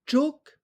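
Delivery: Tchouk high, short, sharp tone; Vietnamese chúc. Shown as a demonstration. Teacher drills Vietnamese syllable for class, high sharp tone. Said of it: "quel son entendez-vous?".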